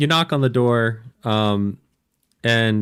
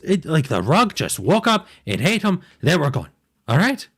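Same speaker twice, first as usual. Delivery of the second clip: higher, frantic gnome telling of the killings; panicked gnome; 7 semitones higher, 3 dB louder than usual. Acted. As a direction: babble it out like a terrified little gnome. DM voices terrified gnome.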